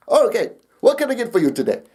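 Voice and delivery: put-on Indian-accented agent taking an order. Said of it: "kept the accent the whole time".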